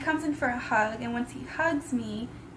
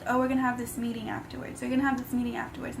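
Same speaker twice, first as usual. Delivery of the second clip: rattled off fast and softer, twice over; rushed repetition; 5 dB quieter, much faster than usual. Retold or imitated. The man repeating his promise: fast, offhand mock repetition. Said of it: "he kept repeating".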